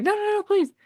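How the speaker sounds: high, frantic pleading; imitating a girl begging not to be taken; mock desperate plea. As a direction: beg in a shrill panic, hammed up. Girl begging as she's captured.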